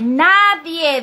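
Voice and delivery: mock shout of a commenter's word. Streamer shouting out a viewer's all-caps comment, loud mock yell. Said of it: "Así gritando".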